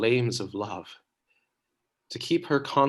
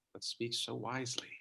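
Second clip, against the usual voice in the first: much softer fast aside; whispered; 15 dB quieter, faster than usual. Acted. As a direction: breathe it out. Troilus tender aside.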